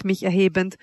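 ordinary delivery

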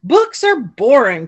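croaking reluctantly; croaked. A narrator's reading. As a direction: croak it out like a grumpy frog. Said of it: "croaked Francine".

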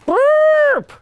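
very high, loud elephant toot; loud trumpeting blast. A narrator's reading. Mummy elephant blowing the naughty mice away, loud trumpeting blast. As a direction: blast out a long trilled toot.

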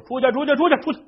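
high, loud shoo to drive the donkey out; loud shooing yell; shooing shout. Acted. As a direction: chase it out the door, loud and high. Driving the donkey out the door.

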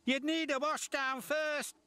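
high, gloating engine voice; teasing James. A narrator's reading. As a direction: tease him in a high, pleased voice. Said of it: "chuckled James".

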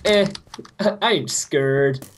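lightly voiced tough villager, slow; an unbothered badass, drawn out a little. A villager shrugging off the fire, mock badass. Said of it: "this villager over here is just a total badass".

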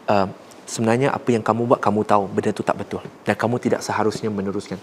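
gentle correction. Shown as a demonstration. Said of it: "Suara saya okey tak?".

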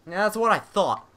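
normal talking voice

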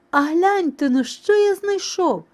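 high, loud excited call. A narrator's reading. Rooster showing mice his find, calling out. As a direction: call them over to see a find. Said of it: "став гукати півник".

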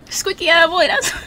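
laughing. Real, not acted. Player mocking her character's squeaky voice, laughing. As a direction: crack up while getting the words out.

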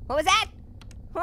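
usual speaking voice

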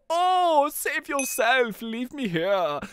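high, slow melodramatic plea; hammed-up dying-martyr cry; noble captive. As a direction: beg the hero to leave you. Voices the self-sacrificing captive.

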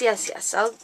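usual speaking voice

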